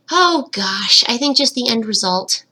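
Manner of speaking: normal talking voice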